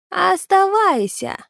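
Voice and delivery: high, slow drawn-out call, falling away; creature's parting word. Acted. Vanishing creature leaves Naal one high, drawn-out command. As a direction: draw the word out like an eerie warning. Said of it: "промолвил Граги".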